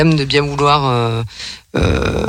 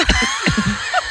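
shrieking laugh; 5 dB quieter than usual. Real, not acted. Laughing at jingle announcement.

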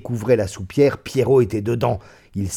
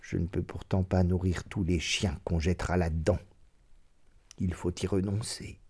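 sour tone; 4 semitones lower, 9 dB quieter than usual. A narrator's reading. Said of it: "d'un ton aigre".

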